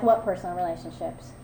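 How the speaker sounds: low deadpan reply. Retold or imitated. Newcomer answering the old man's question about relationships, low deadpan. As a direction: drop it low and quiet, as a throwaway comeback.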